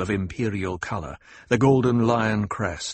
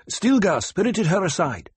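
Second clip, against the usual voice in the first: higher-pitched soldier's answer; lieutenant reporting; 10 semitones higher than usual. A narrator's reading. A lieutenant tells Paul where Chani went, higher-voiced report. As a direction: answer your leader in a higher voice.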